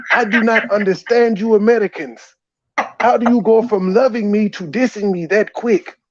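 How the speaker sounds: ordinary talk, nothing marked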